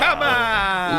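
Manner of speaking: high, loud, exasperated plea; loud protest. Real, not acted. Egging him on again.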